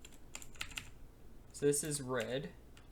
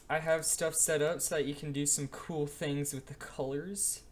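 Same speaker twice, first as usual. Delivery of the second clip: ordinary delivery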